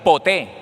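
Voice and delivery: poté with a loud, stressed final é; poté said firmly as po-TÉ. Shown as a demonstration. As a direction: punch the last syllable of poté. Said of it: "con acento en la E".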